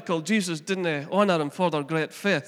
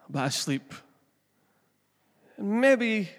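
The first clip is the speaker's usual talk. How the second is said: usual speaking voice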